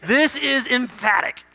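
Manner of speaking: "This is emphatic" slow and high, each word weighted. Shown as a demonstration. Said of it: "I'll do it again more, with more emphasis".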